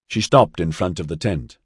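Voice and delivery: usual speaking voice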